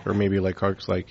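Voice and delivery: usual speaking voice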